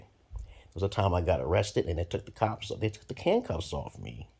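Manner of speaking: ordinary delivery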